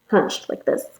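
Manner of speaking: usual speaking voice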